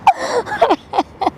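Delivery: distraught sobbing; sobbing outburst. Real, not acted. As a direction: break into sobs, falling in pitch. Woman breaks down mid-confrontation.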